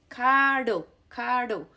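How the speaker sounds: caro with one quick tap; single tap R. Shown as a demonstration. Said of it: "that sounds".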